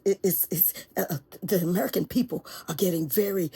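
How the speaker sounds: ordinary delivery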